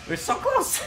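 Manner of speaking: squeaky, strained wail; high squeal. Real, not acted. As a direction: squeal at the near miss. Near win, squeaking.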